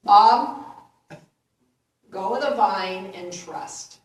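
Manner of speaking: big booming pronouncement, first word blasted; loud booming voice. Acted. Heavenly voice answers man on the cliff, loud booming. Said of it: "loud, booming voice say".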